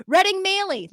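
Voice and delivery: excited name call. Real, not acted. Reading patron names.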